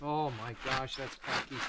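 ordinary delivery

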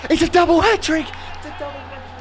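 ecstatic shout. Real, not acted. Gamer screaming as he scores his double hat-trick. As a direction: yell it as the ball goes in.

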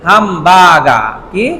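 হামবার্গার with both long vowels held slowly. Shown as a demonstration. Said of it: "দুইটা long ভয়েল আছে".

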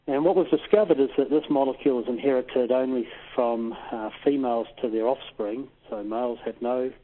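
ordinary delivery

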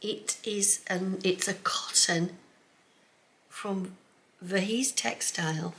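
slow hushed whisper. Real, not acted. Showing the fabric to viewers, whispered. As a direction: breathe it in awe.

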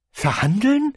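high incredulous repeat; as if he can't believe it. A narrator's reading. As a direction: vizier echoing the hat's word in disbelief. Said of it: "wiederholte er ungläubig".

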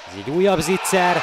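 excited hungarian sports commentary. Real, not acted. Big chance in hockey highlights.

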